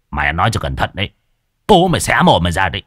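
quick, rough threat; thuggish threat. A narrator's reading. Thug warning the girl to watch her mouth, thuggish threat. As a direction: threaten her fast and hard.

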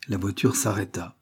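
ordinary delivery

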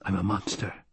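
soft, low self-reproach; inward thought. A narrator's reading. Paul's horrified thought about himself.

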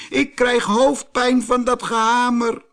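high, loud pleading little voice; little man crying out. A narrator's reading. Jack-in-the-box begging the hammer, high pained cry. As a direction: beg the hammer to stop. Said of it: "riep het mannetje".

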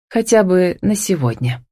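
plain reading voice

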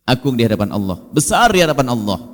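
ordinary talk

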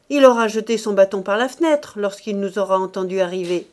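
loud, insistent remark; scornful accusation. A narrator's reading. Rose addressing madame Faujas about Mouret, scornful accusation. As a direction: announce the suspicion loudly.

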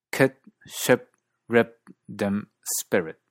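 KIT, SHIP, RIP, DIM, SPIRIT with centralized New Zealand vowel; New Zealand Kit, Ship, Rip, Dim, Spirit, vowel toward 'uh'; New Zealand accent. Shown as a demonstration. Teacher comparing four accents, New Zealand centralized short I. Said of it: "y ciertos neozelandeses".

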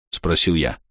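plain reading voice

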